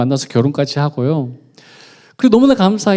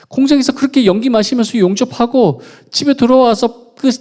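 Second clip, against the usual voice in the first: builds to a high, loud desperate plea; crying out to God; 10 semitones higher, 6 dB louder than usual. Retold or imitated. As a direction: let the exhaustion pour out in a shout. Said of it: "다 토해냈습니다".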